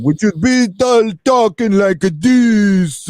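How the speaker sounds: slow, heavy fake Russian accent; mock Russian accent. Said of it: "if you mastered an American accent".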